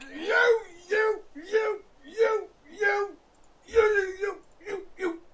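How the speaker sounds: high, crying mock sputter; sputtering teacher. Imitating the furious teacher sputtering at the student, high crying mock. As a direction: stammer you over and over, flustered.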